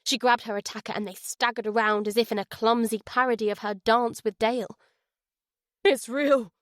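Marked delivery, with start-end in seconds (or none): 5.8-6.5 panicked inner cry; It's real cried in panic; inner scream. Acted. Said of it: "Jack's mind screamed".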